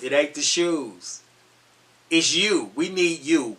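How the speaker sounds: ordinary delivery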